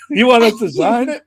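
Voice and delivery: can't believe the ask; incredulous high exclaim; disbelieving. Retold or imitated. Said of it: "disbelief at the request".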